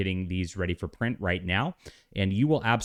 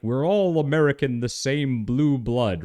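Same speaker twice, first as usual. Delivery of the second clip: mock patriot; 6 semitones higher, 4 dB louder, slower than usual. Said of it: "civic nationalism, sort of like".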